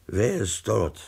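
plain reading voice

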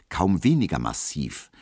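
usual speaking voice

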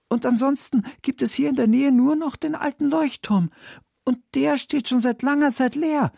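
put-on high, urgent young storybook heroine; Sophia als Figur. A narrator's reading. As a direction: pour out the mystery in a high girl's voice. (hohe, aufgeregte Mädchenstimme)

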